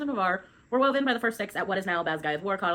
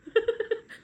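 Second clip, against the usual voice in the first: a quick high-pitched laugh. Real, not acted. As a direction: laugh at the slip. Laughs at own misreading.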